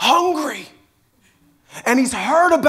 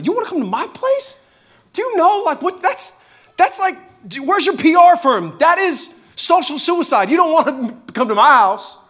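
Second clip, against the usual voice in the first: much higher, stunned disbelief; can't believe Jesus wants to visit, pitched way up; incredulous Zacchaeus; 9 semitones higher, 4 dB louder than usual. Acted.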